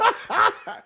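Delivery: real laugh, high and bright; high burst of laughter. Real, not acted. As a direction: crack up laughing. Laughing at friend's confusion.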